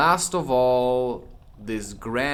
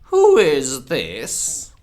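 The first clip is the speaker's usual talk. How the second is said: severe Queen; 3 semitones higher, 9 dB louder, much slower than usual. Acted. The Queen severely demands who Alice is. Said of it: "the Queen said severely".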